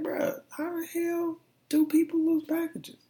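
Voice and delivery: high, exasperated disbelief; incredulous high-pitched rant. Retold or imitated. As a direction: squeak it out in disbelief. Exasperated about a lost package.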